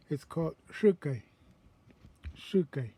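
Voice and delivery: "shukai" diucapkan pelan dengan sh O'odham; O'odham word shukai. Shown as a demonstration. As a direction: say "shukai" with the O'odham sh, tongue behind the teeth. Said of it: "I call it shukai in my dialect".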